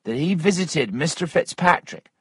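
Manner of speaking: accusing Missus Waters. Acted. Narrator voices Missus Waters.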